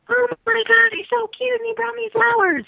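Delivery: put-on high, breathless gushing teen-crush voice; mock giddy girl. Mocking shallow romance to the listeners, in a high giddy gush. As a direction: gush like a smitten schoolgirl, hammed up.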